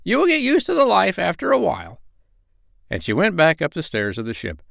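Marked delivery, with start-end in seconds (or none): 0.0-2.0 very high, squawky parrot voice; squawking parrot voice. Acted. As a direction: squawk it out like a bossy old parrot. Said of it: "said Polynesia".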